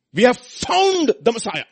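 excited announcement. Acted. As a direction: announce it with overflowing joy. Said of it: "one guy is super excited".

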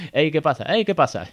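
mock-excited banter; playful loop. Shown as a demonstration. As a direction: toss it back and forth playfully. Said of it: "Es como".

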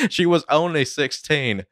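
high Cockney impression breaking into laughter; Michael Caine. Friend retrying the impression, laughing Cockney accent. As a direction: attempt Cockney through giggles. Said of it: "Michael Caine impression video".

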